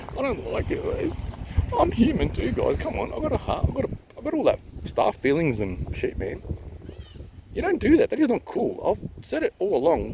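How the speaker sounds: high-pitched, wounded pleading; hurt protest. Real, not acted. Man telling viewers he has feelings too, hurt and pleading. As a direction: plead your case, voice pitched well up. Hurt complaint about being bullied.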